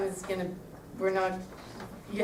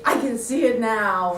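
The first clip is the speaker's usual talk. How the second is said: dramatic; 3 semitones higher, 6 dB louder than usual. Shown as a demonstration. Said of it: "really, you know, dramatically".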